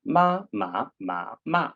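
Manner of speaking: "Ma, ma, ma, ma" high level, rising, dipping, falling; four tones. Shown as a demonstration. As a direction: run "ma" through all four tones in order. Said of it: "it would sound something like this".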